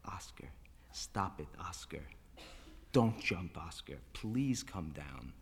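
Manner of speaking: very soft, low, near-whispered plea; hushed, slow begging; exhausted pleading. Acted. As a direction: beg weakly, with no strength left. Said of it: "he pleaded".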